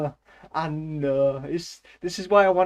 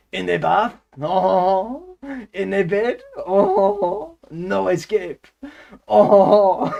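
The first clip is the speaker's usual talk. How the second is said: loud, high-pitched hammed-up French with long ohs; over-the-top French accent, big drawn-out ohs; 5 semitones higher, 10 dB louder, much slower than usual. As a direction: wail it like a melodramatic Frenchman. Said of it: "I can do the French accent".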